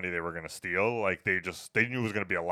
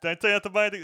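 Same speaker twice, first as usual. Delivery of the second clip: mock scolding; 12 semitones higher, 5 dB louder, much faster than usual. Mock De Niro scolding, first take.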